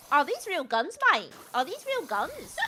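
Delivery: mock Australian lad accent with swooping pitch; imitated Australian lads. Host retelling lads in an airsoft shop, mock Australian accent. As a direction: imitate an excited Aussie lad asking. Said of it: "I heard them like".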